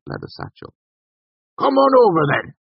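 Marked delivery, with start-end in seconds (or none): 1.6-2.5 high, loud rustic call; calling over. A narrator's reading. Cobb called to the newcomer, shouting over. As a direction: beckon a stranger from across the room. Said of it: "Cobb called to him".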